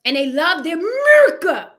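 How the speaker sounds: mock Trump fan. Mocking Trump fans.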